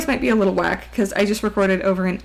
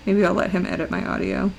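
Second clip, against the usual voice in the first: normal talking voice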